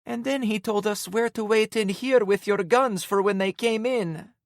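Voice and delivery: put-on high, squeaky tearful girl voice. A narrator's reading. Em telling the narrator how he saved them, squeaky. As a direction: squeak it out through tears. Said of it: "Em squeaked".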